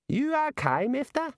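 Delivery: very high Aussie barman; Dongo's Aussie voice. Acted. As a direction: ask it like a worried barman.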